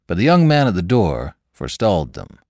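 usual speaking voice

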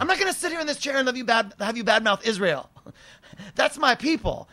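high-pitched, rushed mock outrage; weird anger. Woman defending Israel to a stranger, high mock indignation. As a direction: imitate an indignant woman, voice jumping up. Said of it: "there's a weird anger on this".

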